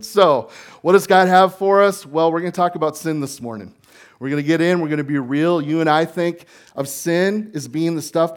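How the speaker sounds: loud, high, driving sermon voice; preaching at full force. Acted. Pastor preaches Romans chapter one to the congregation, loud and fervent. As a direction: preach it out to the room.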